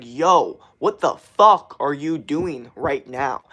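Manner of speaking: loud, slow, punched-out confrontation; talking at viewers. Shown as a demonstration. Streamer demonstrating talking at his audience, forceful. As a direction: hit each word right at the listener. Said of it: "at you guys".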